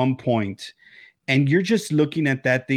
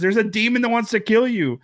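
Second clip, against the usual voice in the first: ordinary talk, nothing marked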